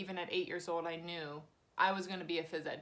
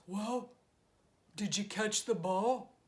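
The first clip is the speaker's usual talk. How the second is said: a light take on dad's voice, slow and drawn out; dad asking slowly, lightly imitated; imitating dad; slower than usual. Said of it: "I'll do his, as he actually sounds like this".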